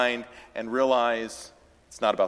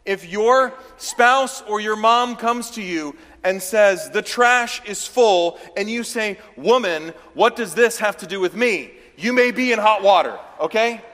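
animated preaching voice, higher and louder; lively preaching; 7 semitones higher, 6 dB louder than usual. Acted. Preacher warning the church about answering mom, lively and loud. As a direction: drive the joke home with energy.

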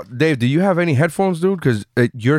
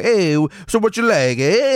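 movie Italian waiter; 6 semitones higher than usual. Retold or imitated. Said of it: "speaking like Italians do in the movies".

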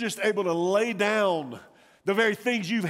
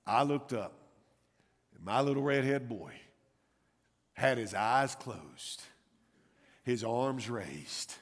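hushed buildup; 7 semitones lower, 7 dB quieter than usual. A narrator's reading. Building to the big drop.

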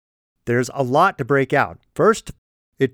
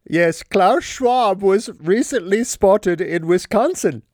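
put-on higher, joking German accent mixed with Wisconsin; mock Klaus Schwab accent; 5 semitones higher than usual. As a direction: tease a German accent over a Midwest phrase. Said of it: "sometimes when I mix accents".

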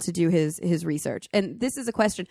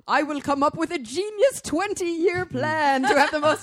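mock genius producer; 9 semitones higher than usual. Mocking TV producers' grand twenty-year plan, grandiose and high. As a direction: ham up a scheming producer's big plan. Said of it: "these are producers who are like".

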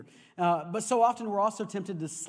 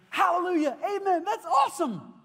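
much higher, louder jubilant cry; imagined excited praise; 10 semitones higher, 6 dB louder than usual. Retold or imitated. Imagined friend cheering the news, excited. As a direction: burst out like a thrilled church friend. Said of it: "And I expected to hear".

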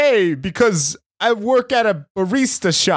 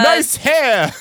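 leering catcall; 6 semitones higher than usual. Pretend. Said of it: "I'm catcalling".

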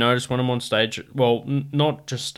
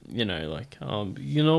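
ordinary talk, nothing marked

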